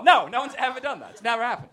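ordinary talk, nothing marked

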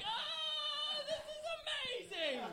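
high, strained falsetto yell of delight; a hammed-up ecstatic toilet scream; mock scream. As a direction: squeal it way up high like a blissful scream. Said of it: "I scream when I poop".

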